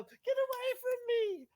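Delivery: shrieking ghostly howl, very high; unearthly voice. Acted. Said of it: "she howls in an unearthly voice".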